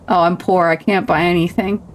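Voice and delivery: ordinary talk, nothing marked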